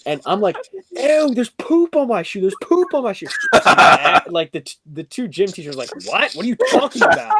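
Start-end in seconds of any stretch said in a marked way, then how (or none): 1.0-3.3 high, loud disgusted squeal; grossed-out panic. Retold or imitated. Student freaking out to gym teachers, grossed out. 6.1-7.3 loud, indignant retort; mad gym teachers. Retold or imitated. Gym teachers answering a freaked-out student, mad. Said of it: "they actually got, like, mad".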